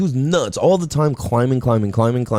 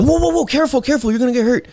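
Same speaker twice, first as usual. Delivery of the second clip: imitated fretful warning, much higher; mock alarmed parent; 11 semitones higher, much faster than usual. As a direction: gasp it like a nervous parent. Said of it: "easy to say things like that".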